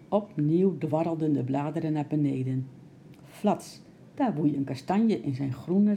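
plain reading voice

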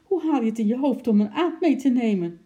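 high, loud, fast scolding; angry. A narrator's reading. Wife scolds husband for bringing an ape, angry. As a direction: tell him off in a rush. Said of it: "zei ze boos".

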